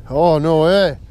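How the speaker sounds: usual speaking voice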